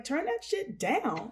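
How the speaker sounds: high, drawn-out imitation of griping; mock sibling complaint. Siblings griping about clarinet practice, mock exasperation. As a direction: imitate fed-up siblings, slow and high-pitched. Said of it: "my siblings would be like".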